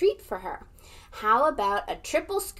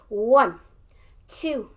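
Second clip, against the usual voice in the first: loud count; 3 semitones higher, 6 dB louder, much slower than usual. Acted. Counting to class yell.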